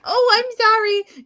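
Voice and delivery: high, slow, sheepish little sorry; mock apology. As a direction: play the flustered caller apologizing. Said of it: "'cause I would have been like".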